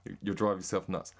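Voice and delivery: ordinary talk, nothing marked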